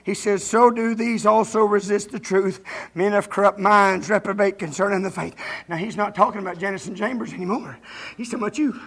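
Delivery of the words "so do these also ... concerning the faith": scripture reading. Real, not acted. Preacher returning to the verse for the church, solemn reading.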